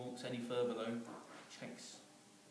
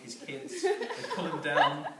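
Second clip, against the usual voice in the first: high, louder words breaking with laughter; barely holding it together; laughing through words; 9 dB louder than usual. Real, not acted. Teller describing the pigeon's kids, laughing through it.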